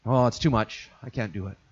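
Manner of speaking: low, soft, hesitant inner voice; mild reluctant murmur. Retold or imitated. Churchgoer talking himself out of commitment, low and soft.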